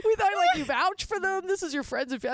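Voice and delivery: high, loud, laughing disbelief; shocked protest. Retold or imitated. Wife learning the neighbours are swingers, acted shocked protest.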